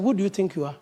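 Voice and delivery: muttered self-check, said inward; to oneself. Shown as a demonstration. Preacher questioning himself privately, softly to himself. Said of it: "then I just talk to myself".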